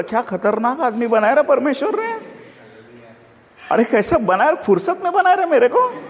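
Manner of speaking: high-pitched comic marvelling at himself; mock self-admiration. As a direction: gush at your own reflection, pitch climbing very high. Admiring himself in the mirror, acted. (खुद पर फ़िदा होने का नाटक, ऊँची आवाज़ में)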